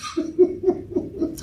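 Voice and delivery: high, soft, breathy chuckle; light, stuttering laugh; amused chuckle. Real, not acted. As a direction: chuckle softly at your own imitation. Laughs after mocking prayer warriors.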